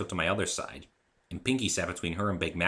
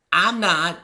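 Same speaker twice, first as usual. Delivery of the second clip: innocent; 12 semitones higher, 12 dB louder than usual. Acted. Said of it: "she said innocently".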